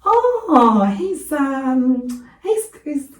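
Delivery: high, hesitant coo; put-on sweet voice, slowing onto the compliment; tactful fake cooing. Shown as a demonstration. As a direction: hunt for something nice to say about the baby. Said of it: "I always go".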